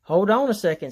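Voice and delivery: loud protest. A narrator's reading. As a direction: stop them sharply. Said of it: "says the witch".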